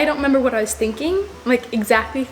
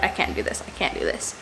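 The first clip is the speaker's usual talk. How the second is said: defeated whisper; 7 semitones lower, 7 dB quieter than usual. Retold or imitated. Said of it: "take it off and be like".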